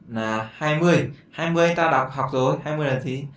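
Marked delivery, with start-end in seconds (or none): none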